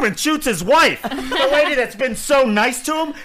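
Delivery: high, loud words broken by real laughter; can't get through the story for laughing; giggling high and loud through the shocking part; laughing while telling. Real, not acted. Laughing while retelling the shooting.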